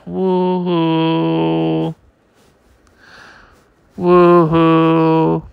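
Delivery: a huge booming wail that rattles the ship; sonic bellow. Acted. Giant boss wails his sonic voice at the ship.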